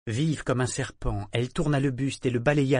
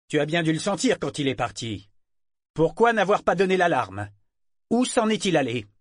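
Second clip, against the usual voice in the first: questions climbing higher and louder; rising angry demand; 7 semitones higher, 4 dB louder than usual. Acted. Kyle demanding answers from the figurehead, climbing anger. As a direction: press her harder with each question.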